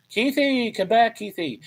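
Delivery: high, pleading mock call; calling someone back. Host calls after a lost commenter Keithy, high mock plea. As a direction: call out playfully after someone who slipped away.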